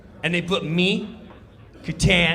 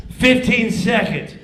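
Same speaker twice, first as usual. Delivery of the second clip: loud countdown; stage manager calling thirty seconds; 6 semitones higher, 10 dB louder than usual. Retold or imitated. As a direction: bark the time left.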